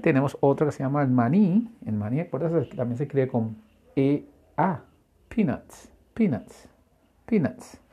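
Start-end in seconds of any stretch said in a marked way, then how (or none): 5.3-7.8 PEANUTS repeated higher and falling, t sounded; final T pronounced. Shown as a demonstration. Teacher drilling learners, PEANUTS with sounded t. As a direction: model each PEANUTS separately with its final t. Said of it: "Acuérdate de pronunciar la T al final".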